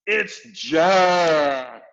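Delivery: loud, high terrified bellow. A narrator's reading. Giant bellowing in his nightmare. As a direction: roar out the name in panic. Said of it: "bellowed the flesh lump eater".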